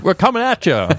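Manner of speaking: hammed-up radio announcer; cheesy DJ hype. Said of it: "mashup of radio voices".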